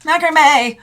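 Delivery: loud amused outburst; loud exclamation. Real, not acted. Exclaiming loudly at a macrame earring. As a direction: shout the word in disbelief.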